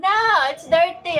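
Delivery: loud, high mock protest at a viewer; loud teasing protest. Repeating the phrase for viewers.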